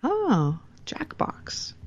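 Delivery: ordinary talk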